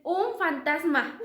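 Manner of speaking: slow mock frightened cry; mock fright. Disciples in the house, slow mock fright. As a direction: stretch it out, spooked.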